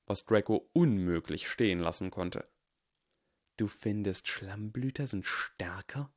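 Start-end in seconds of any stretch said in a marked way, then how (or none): 3.6-6.1 slow, hushed whisper of disbelief; incredulous whisper. Acted. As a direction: breathe the challenge out almost soundlessly. Draco challenges, cannot let it stand.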